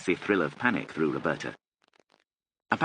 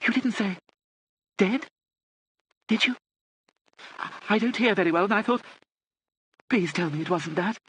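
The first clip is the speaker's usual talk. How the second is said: put-on high, halting old-lady voice; shocked pleading; 13 semitones higher than usual. A narrator's reading. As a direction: plead haltingly, pitched right up. Deaf lady pleads after terrible news.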